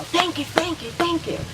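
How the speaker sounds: grateful, almost out of breath; jazzed thanks. Shown as a demonstration. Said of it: "I'm getting jazzed".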